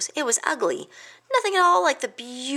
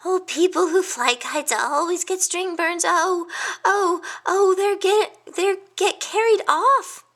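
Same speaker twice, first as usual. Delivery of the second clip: high, louder anxious sputtering; insisting hedgehog; 11 semitones higher, 4 dB louder than usual. A narrator's reading. Hedgehog insisting kites are dangerous, high sputtering panic. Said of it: "Quince insisted".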